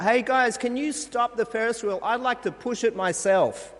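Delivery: mock shout up from the top of a Ferris wheel; playful pretend yell from a ride; calling out. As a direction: call out loud and high to the operators. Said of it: "I called out".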